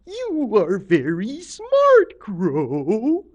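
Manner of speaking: slow, swooping, loud creature voice; flattering octopus lady. A narrator's reading. Octopus Lady flatters Crow, as a slow swooping creature. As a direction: draw out every word high, then slide down. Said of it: "and she said".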